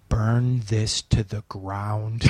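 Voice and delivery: a dark voice inside urging destruction; sinister inner voice. Retold or imitated. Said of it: "This voice in my head goes".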